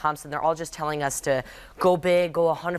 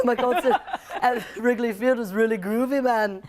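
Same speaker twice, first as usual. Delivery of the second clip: [name] impression; 5 semitones higher than usual. Guest on a talk show doing [name], higher, Liverpool accent. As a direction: do a laid-back Liverpool Beatle. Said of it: "[name]'s like".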